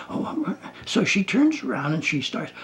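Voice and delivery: normal talking voice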